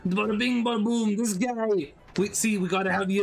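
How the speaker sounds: playful mock Italian gangster bravado; fake Italian accent. Streamer hamming a mock Italian accent for chat. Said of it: "fake Italian accent like we got here".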